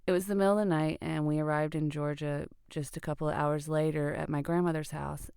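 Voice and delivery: ordinary delivery, nothing marked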